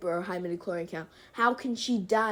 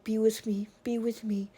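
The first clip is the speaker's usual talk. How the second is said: mock whisper; 5 dB quieter than usual. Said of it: "she's like".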